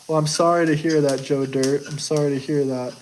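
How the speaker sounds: normal talking voice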